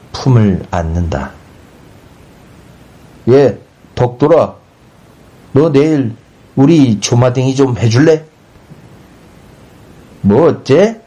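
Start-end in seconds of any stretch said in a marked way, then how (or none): 3.3-8.2 neighbour calling over, pitched up and lively; lightly acted villager asking a favour. A narrator's reading. 10.2-11.0 high, rising cry of outrage; indignant yell. A narrator's reading. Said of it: "소리를 뻑 지르고는".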